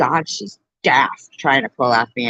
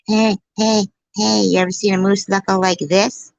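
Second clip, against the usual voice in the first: flirty trashy neighbor; 5 semitones higher than usual. Retold or imitated. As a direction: call it out teasingly.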